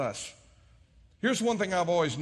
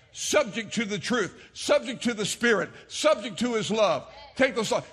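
preaching build; 5 semitones higher, 5 dB louder than usual. Real, not acted. Preaching cadence.

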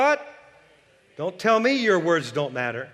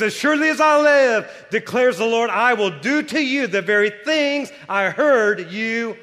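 louder and higher, declaring like God himself; booming voice of God; 4 semitones higher, 5 dB louder than usual. Retold or imitated. Preacher booming God's oath to the congregation. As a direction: proclaim it as the Lord. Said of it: "He says".

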